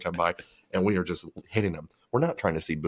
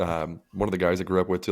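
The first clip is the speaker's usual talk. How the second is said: usual speaking voice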